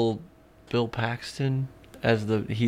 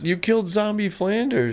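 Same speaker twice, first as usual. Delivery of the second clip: high cartoon character voice; cartoon quote; 9 semitones higher than usual. Quoting a Simpsons joke to a friend, high cartoon voice. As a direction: squeak it out like a cartoon kid.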